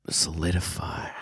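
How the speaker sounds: slow, unvoiced whisper; whispered to oneself. Real, not acted. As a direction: whisper it while looking it up. Murmurs term while looking it up.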